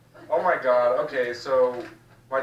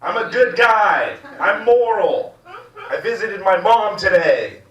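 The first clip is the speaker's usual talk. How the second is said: high, slow mock-righteous shouting; loud boasting; 5 semitones higher, 6 dB louder than usual. Comic bragging to the crowd about visiting mom, loud proclaiming. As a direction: declaim it slowly at full volume.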